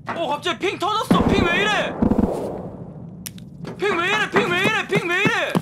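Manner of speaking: rising frustrated yelling at lag; escalating angry complaints about ping; raging gamer. Real, not acted. Ping suddenly spikes mid-game.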